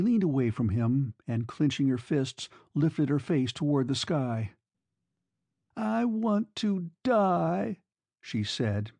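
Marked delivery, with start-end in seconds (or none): 5.8-7.8 high, very slow and deliberate; molding each word. A narrator's reading. Girl despairing before her lover, each word slow and careful. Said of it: "as if molding each word carefully in her heart".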